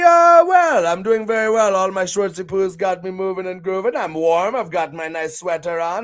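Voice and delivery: ordinary delivery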